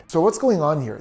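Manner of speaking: usual speaking voice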